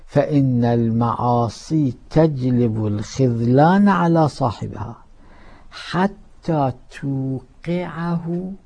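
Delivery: loud, slow preaching of the hadith; hadith recitation. Acted. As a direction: declaim the warning to the congregation.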